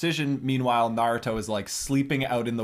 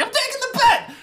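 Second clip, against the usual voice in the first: eager Naruto yell; 5 dB louder than usual. Retold or imitated. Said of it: "Naruto's like".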